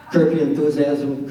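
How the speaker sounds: ordinary talk, nothing marked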